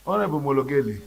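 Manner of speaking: usual speaking voice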